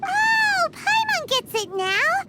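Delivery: excited realization. Acted. Paimon suddenly understands.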